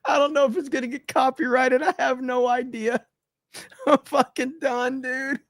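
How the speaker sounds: high-pitched, giggly disbelief; laughing while talking. Real, not acted. Streamer still cracking up after the clip, laughing speech. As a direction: talk through the giggles.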